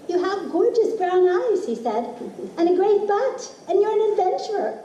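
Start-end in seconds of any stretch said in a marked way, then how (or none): 0.1-1.6 higher, light mock voice of husband; imitating Adam. Narrator voicing husband's compliment, higher playful imitation. 2.6-4.8 much higher, playful teasing voice, quicker; hammed-up flirty husband voice; imitating Adam teasing. Narrator mimics husband teasing her, higher and playful.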